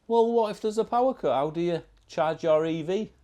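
high, loud silly objector voice; mock sceptic. YouTuber parodying doubters about electric cars, high mocking voice. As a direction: parody the worried question in a high put-on voice.

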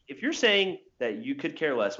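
usual speaking voice

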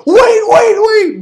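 frantic high-pitched squeal, loud; Maria. Retold or imitated. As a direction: cry out in mock panic. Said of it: "She goes".

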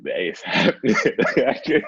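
giddy replay of breaking the news; laughing retold excitement. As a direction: laugh through the big reveal to a puzzled wife. Repeats it to puzzled wife.

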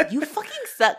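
mock outburst. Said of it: "Alex is like".

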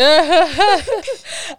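loud high cackle; high laughter. Real, not acted. Friend cracks up at her reaction, high laugh.

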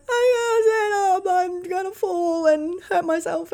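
high, teary mock panic; scared whine. Nervous rider answering her partner, high and teary. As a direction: whimper like you're about to fall. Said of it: "it's fucking scary".

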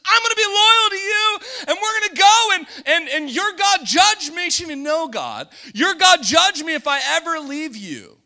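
loud, high, tearful vow; Ruth vowing with a sob. Retold or imitated. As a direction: swear your loyalty, voice breaking. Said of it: "She just says".

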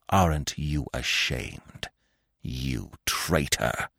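restrained, low and slow growl; soft, slow, bitter accusation held in; embittered growl. A narrator's reading. Wounded fox shaming the dog, restrained bitter growl. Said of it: "restrained and embittered, he growled".

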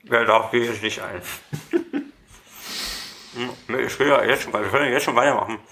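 talking with mouth full; words round a mouthful of brownie. Real, not acted. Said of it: "Aber mit vollem Mund vorm Mikrofon zu sitzen".

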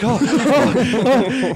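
high, loud flustered stammer; flustered customer. Retold or imitated. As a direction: stammer like a man caught off guard. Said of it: "He's like".

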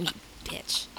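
under-the-breath whisper; a retold whispered curse; whispered insult. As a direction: hiss it so nobody hears. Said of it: "So I'm like".

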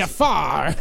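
cartoon parrot sidekick squawk; Iago. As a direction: deliver it as the cartoon parrot's big line. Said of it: "now say the line".

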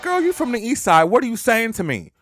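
high, loud sassy retort; sassy comeback. Retold or imitated. To her mother about the east side, sassy and loud. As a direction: talk back with attitude. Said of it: "And I'm like".

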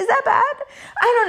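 high, giggly question; sheepish giggling question; laughing. Real, not acted. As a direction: laugh through the words, embarrassed. Laughs at own confession.

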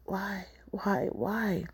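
breathy whisper, barely voiced; whispered why. Poet whispering her opening question.

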